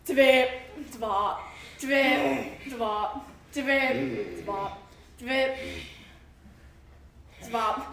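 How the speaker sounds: very loud heavy bridge stomps; heavy stomping. A narrator's reading. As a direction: stomp out the big goat's steps. Heavy bridge, big goat.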